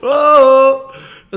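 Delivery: cathedral echo. Real, not acted. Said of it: "t'as vu comment ça résonne ici?".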